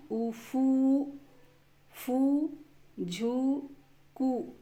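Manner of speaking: उफू, फू, झू, कू with the oo stretched out slowly; long oo. Shown as a demonstration. Teacher showing how the big oo sign sounds, long oo in फू, झू, कू. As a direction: hold the oo long in फू, झू, कू. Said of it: "तो उच्चारण".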